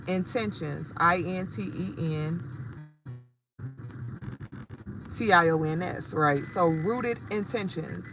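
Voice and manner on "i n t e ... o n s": slow letter spelling. Shown as a demonstration. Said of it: "spelling the handle".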